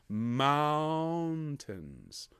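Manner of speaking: "mountains" with a long drawn-out "ow"; "mountains" slowly, first syllable held wide; open first vowel. Shown as a demonstration. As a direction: stretch the "ow" of "mountains", then a light short ending. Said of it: "We want an ow on the first syllable".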